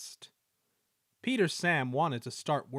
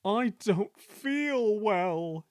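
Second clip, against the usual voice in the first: slow, higher moaning complaint, faked; fake groan; 8 semitones higher, 4 dB louder, much slower than usual. A narrator's reading. Engine pretending to be ill groans to his crew, slow and whiny. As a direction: groan it like a shamming invalid. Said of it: "he groaned next morning".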